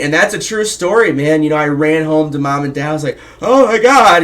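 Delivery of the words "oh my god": high, loud oh my God; overexcited teen blurting to mom and dad; breathless excitement. Retold or imitated. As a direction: burst in on the parents thrilled. Kid runs home to parents.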